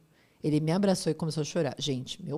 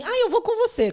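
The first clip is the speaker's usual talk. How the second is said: imitating mother; 14 semitones higher, 7 dB louder than usual. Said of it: "minha mãe assim".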